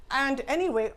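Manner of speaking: usual speaking voice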